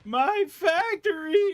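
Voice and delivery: high, drawn-out mock wail. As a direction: wail over the lost factory. Factory destroyed.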